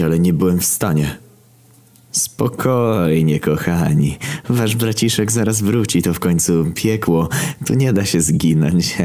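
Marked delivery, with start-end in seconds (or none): 2.1-9.0 mock-soothing villain. Villain soothing brothers of the slain, mockingly sweet. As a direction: stretch the first word sweetly, toying with them.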